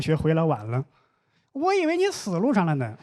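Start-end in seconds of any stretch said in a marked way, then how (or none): 1.5-2.9 high-pitched exasperated jab; mock scolding dad. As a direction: greet the latecomer with a high, scornful scold. Dad greets late child harshly. (模仿爸爸尖着嗓子数落晚归的孩子)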